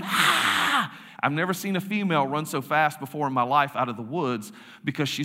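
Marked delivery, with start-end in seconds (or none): 0.0-1.0 mock girls' scream. Girls spot a masked man in the cemetery, mock scream. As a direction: squeal like a startled girl, held back. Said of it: "you hear girls just start screaming".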